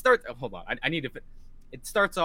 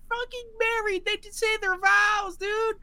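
much higher, gushing; emotional high gush. Real, not acted. Fan recounting the wedding scene, high gushing.

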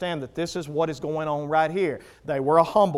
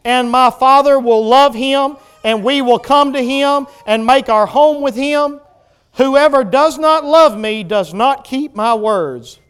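loud, raised scripture reading; full-force preaching; 5 semitones higher, 10 dB louder than usual. Acted. Preacher reads Christ's words to the congregation, full-force preaching. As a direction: proclaim the verse with force.

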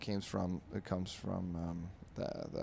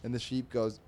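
ordinary talk

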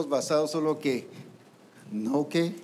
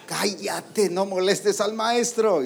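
raised, drawn-out telling-off; higher, louder mock rebuke; acted scolding; 5 semitones higher, 4 dB louder, slower than usual. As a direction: shush a beggar loudly, stretching it out. Disciples silencing the blind man, acted.

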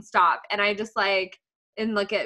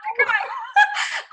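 teary laugh. Real, not acted. Laughing while tearing up.

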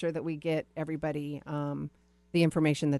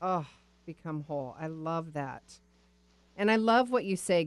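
ordinary talk

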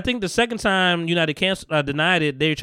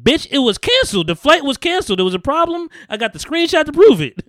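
much higher, louder indignant protest; mock indignant rant; 9 semitones higher, 5 dB louder than usual. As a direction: insist you've got proof, fed up. Retold rant at reservations agent.

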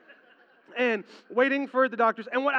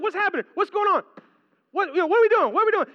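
high, loud frantic questions; panicked yelling; 10 semitones higher, 7 dB louder, faster than usual. Retold or imitated. Freaked-out husband demanding answers in delivery room, high panicked shout. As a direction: shout them out in rising panic. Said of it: "I'm like".

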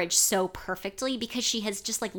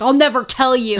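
loud, higher-pitched mock refusal; mock defiance; 4 semitones higher, 10 dB louder than usual. Host mimics boy refusing under questioning, loud mock defiance. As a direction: blurt it out like a stubborn hero.

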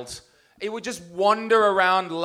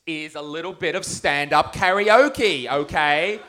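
normal talking voice

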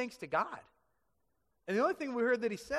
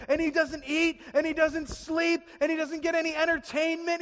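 high, loud, agitated telling; frantic narration; 8 semitones higher, 6 dB louder than usual. A narrator's reading. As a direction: carry the panic into the storytelling. Narrating the king's panic.

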